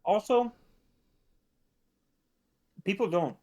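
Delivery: talking through a mouthful of chips; mouth full. Real, not acted. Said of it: "talking about my mouthful of chips".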